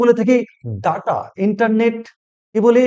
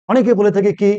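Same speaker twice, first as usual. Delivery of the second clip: ordinary talk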